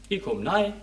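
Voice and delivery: ordinary delivery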